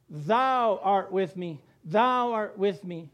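slow, deliberate, thou art with me; slow emphatic recital. Shown as a demonstration. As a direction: stress THOU, slowly and firmly. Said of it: "What's the next sentence?".

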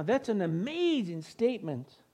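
ordinary talk, nothing marked

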